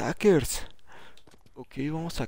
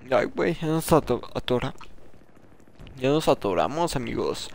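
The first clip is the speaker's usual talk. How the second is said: usual speaking voice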